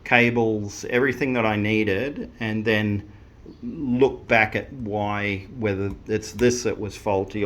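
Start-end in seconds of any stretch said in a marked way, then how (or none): none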